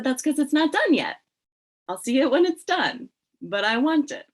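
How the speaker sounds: high, loud comeback; retold eager insistence. Editor begging for the unseen project, retold eager insistence. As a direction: push back eagerly, pitched well up.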